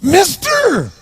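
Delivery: put-on odd high-pitched call of a stumbling drunk; bizarre voice. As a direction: squeal it like an odd drunk beggar. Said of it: "He had this really bizarre looking sounding voice".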